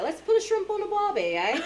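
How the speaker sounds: very high, playful mock-Australian voice; Australian accent. Shown as a demonstration. Parent rousing the kids on a rough morning, Australian accent. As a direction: put on a squeaky Australian accent. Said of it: "I think it's Australian or something".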